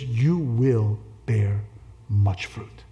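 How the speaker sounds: slow, low, measured "You will bear much fruit"; emphatic promise. Retold or imitated. Contrasting a promise with may, hope.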